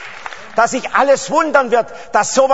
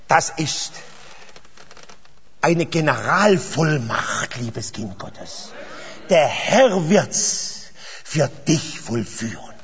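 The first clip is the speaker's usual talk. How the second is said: much softer, lower, slow promise; hushed, intimate; 6 semitones lower, 6 dB quieter, much slower than usual. Real, not acted. Preacher softly assuring one believer, hushed. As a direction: lean in and say it gently.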